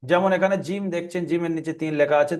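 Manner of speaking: normal talking voice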